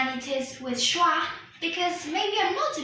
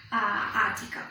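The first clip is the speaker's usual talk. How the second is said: normal talking voice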